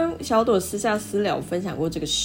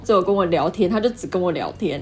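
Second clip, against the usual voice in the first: usual speaking voice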